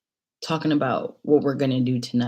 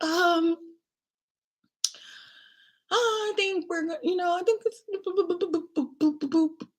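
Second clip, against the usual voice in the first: tiny high-pitched hedging voice; awkward stalling; 14 semitones higher, 3 dB quieter than usual. Retold or imitated. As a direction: imitate hedging in a squeaky trail of filler. Stalling awkwardly before telling friend.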